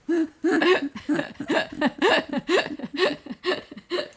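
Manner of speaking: long, loud, high cackling laugh; helpless cackle. Real, not acted. Cackles after group hug.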